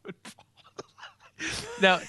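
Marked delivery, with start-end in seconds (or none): 0.0-0.5 imitating the announcer. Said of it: "I am fed up".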